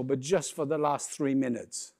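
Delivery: usual speaking voice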